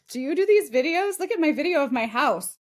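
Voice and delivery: imitated eager client. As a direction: pipe up like an enthusiastic client. Imitating client showing off a video.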